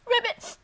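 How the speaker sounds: high, loud croaking laugh; ribbit laugh. Acted. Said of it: "croaked a little ribbit laugh".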